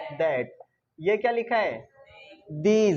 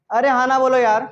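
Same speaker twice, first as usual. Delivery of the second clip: louder, higher plea for a response; exasperated prodding; 4 semitones higher, 8 dB louder than usual. Real, not acted. Teacher urges unresponsive students to answer, loud and exasperated. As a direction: prod a silent class, loudly. Prods silent class to answer.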